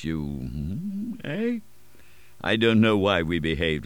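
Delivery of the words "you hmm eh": softer, hushed and conspiratorial; hushed question with a lifted eh; low voice. A narrator's reading. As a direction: lean in and murmur it. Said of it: "in a low voice".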